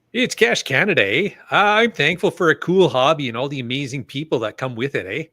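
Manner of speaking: hammed-up Canadian with eh; mock Canadian accent with tacked-on eh. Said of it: "do this next one with the proper Canadian accent".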